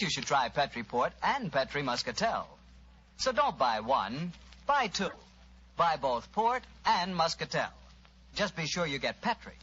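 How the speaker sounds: ordinary delivery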